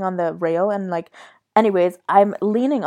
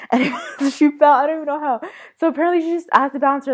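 high-pitched, laughing as you talk; 7 semitones higher, faster than usual. Real, not acted. Teller recalling friend's fall, laughing. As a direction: break into laughter while telling.

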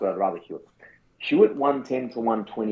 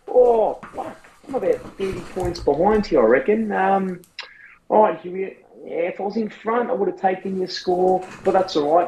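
panicked coach; 7 semitones higher than usual. Pretend. Acted coach on Sunday.

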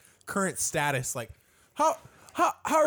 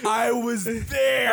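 shouting; 4 semitones higher, 4 dB louder, much slower than usual. Pretend. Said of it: "shouting at the screen".

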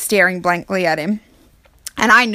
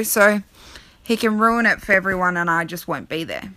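ordinary talk, nothing marked

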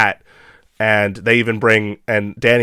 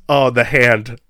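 usual speaking voice

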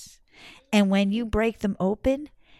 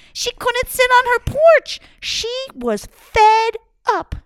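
high, loud, slow exasperation; fed up; 13 semitones higher, 7 dB louder than usual. A narrator's reading. Teller shows the woman's frustration, high exasperated. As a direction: sound completely fed up.

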